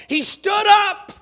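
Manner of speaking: jolted awake. A narrator's reading. Drunk jumps awake.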